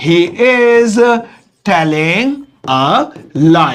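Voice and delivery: slow, separated words with pauses; word-by-word dictation. Shown as a demonstration. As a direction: dictate slowly as if writing it out. Dictating word by word while writing. (शब्द-दर-शब्द, धीरे-धीरे लिखवाते हुए)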